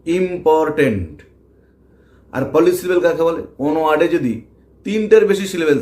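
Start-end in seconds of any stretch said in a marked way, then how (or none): 0.1-1.1 important slow, split as ইম-পর-টেন্ট; syllable by syllable. Shown as a demonstration. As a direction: slowly give important three separate beats. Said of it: "কেটে কেটে উচ্চারণ করি". (Important, ধীরে কেটে কেটে)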